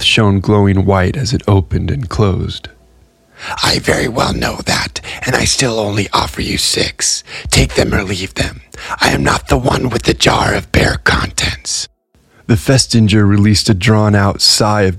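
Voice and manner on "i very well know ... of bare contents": Tally Keeper's voice. A narrator's reading. Gray refuses to haggle.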